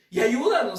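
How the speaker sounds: a father calling out for help; crying out. Acted. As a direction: call out for help. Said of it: "clamando su misericordia".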